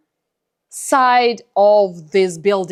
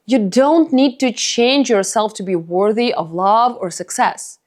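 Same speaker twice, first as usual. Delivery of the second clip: ordinary talk, nothing marked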